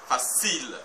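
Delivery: facile, long strong final syllable; stress on CIL. Shown as a demonstration. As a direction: lean on the end of facile. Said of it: "c'est cil, plus fort que fa".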